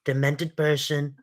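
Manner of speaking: Trump-style rally riff, repetitive and emphatic; Trump impression. Mocking right-wing talking points in a Trump impression. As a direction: do Trump, bragging and outraged by turns.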